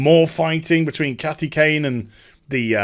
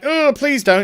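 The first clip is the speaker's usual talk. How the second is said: higher whiny plea; mock pleading; 9 semitones higher than usual. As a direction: imitate a hapless guard begging. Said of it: "he's just like".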